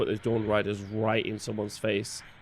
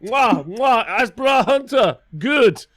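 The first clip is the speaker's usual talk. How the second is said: put-on high, loud, hammy French accent; French chef; 10 semitones higher, 7 dB louder than usual. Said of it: "That's my French".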